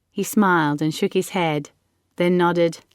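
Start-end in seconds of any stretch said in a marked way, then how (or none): none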